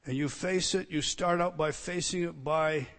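soft, hushed preaching; quiet preaching. Acted. Preacher to congregation, hushed and intimate. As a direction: lean in and preach it softly.

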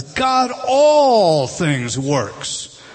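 all things drawn out very slowly and loudly; emphatic stress on all. Shown as a demonstration. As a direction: shout out God all things works, stretching every word. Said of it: "this is what he wants to emphasize".

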